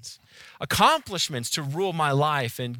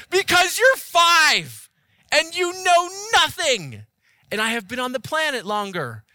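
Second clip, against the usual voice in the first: hammed-up exasperated yell, higher and louder, fading at the end; mock parent rant; 6 semitones higher, 6 dB louder than usual. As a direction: overplay a frazzled parent losing patience. Parent ranting at child.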